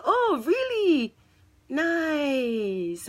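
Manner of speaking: high, slow, put-on 'oh really' with an awkward brush-off; awkward fake interest. To the church lady, awkwardly fake-pleased. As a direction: feign polite interest while backing away. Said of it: "I walked away awkwardly".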